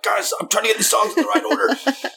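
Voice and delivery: very high, comic fluster; mock-panicked squeal; frantic. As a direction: plead over a rowdy room, hammed up. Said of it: "we download all the songs frantically".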